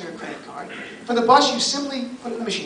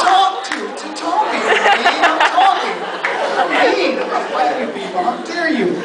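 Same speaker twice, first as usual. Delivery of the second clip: mock outrage; 5 semitones higher, slower than usual. Mom scolding her son for the joke. Mom's indignant answer.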